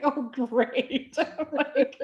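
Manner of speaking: high, laughing mock despair; amused retold panic; laughing dismay. Laughing retold realisation of hallucinating.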